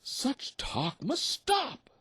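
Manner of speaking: very high, slow duck voice; squeaky mother duck demanding; crying out mother duck. A narrator's reading. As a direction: put your foot down as a mother. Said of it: "the mother cried".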